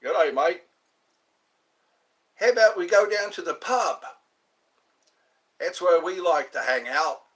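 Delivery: put-on Australian accent, a friendly pub invitation. Practising an Australian accent for viewers, loud friendly Australian voice. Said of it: "I'm working on my Australian accent".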